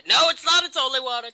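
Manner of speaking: high, loud mock panic; urgent protest. Acting out a holy water bluff, urgent high protest.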